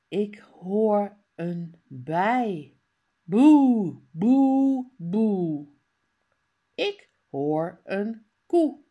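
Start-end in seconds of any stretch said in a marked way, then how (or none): 3.2-4.9 cow moo. Retold or imitated. Imitating cow.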